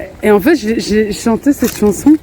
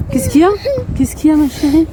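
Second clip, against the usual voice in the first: comforting; 6 semitones higher, faster than usual. Real, not acted. Comforting crying daughter.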